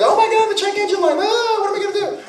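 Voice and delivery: very high, fast, frantic squeal; mock panic over a dashboard light; shrill helpless mock panic; panicky high voice. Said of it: "Check engine light goes".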